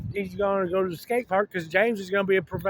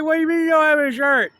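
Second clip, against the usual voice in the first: much higher, louder and fast, mock outrage; outraged James; 7 semitones higher, 6 dB louder, faster than usual. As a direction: fire it back in disbelief, high and loud. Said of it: "James is like".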